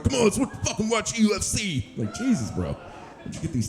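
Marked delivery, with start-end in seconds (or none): 0.0-1.9 meathead bro voice. Retold or imitated. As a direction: hype yourself up like a roided gym bro. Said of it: "It's like".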